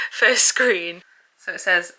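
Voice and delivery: usual speaking voice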